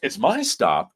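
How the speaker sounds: normal talking voice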